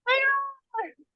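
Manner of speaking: very high, drawn-out call; imitated call back. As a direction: echo the greeting high and stretched. Imitating the group calling back.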